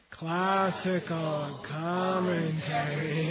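stretched very slow, syllables spaced apart; slow drawn-out classroom reading; slow read-along. Shown as a demonstration. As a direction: model it slowly for the students. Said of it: "let's do these two phrases".

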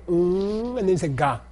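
a long held MM, then GA on its own; prenasal broken down. Shown as a demonstration. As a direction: stretch the "mm" before releasing a hard "ga". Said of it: "you're just kinda setting up".

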